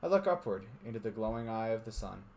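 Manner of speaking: steady narrator reading a visual novel; story narration. A narrator's reading. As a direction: read it like a storyteller.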